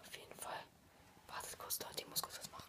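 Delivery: whispering. Real, not acted. Whispering beside sleeping friend.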